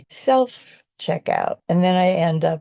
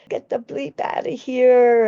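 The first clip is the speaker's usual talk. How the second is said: retold yell; 6 semitones higher, 4 dB louder than usual. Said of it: "he screamed at me".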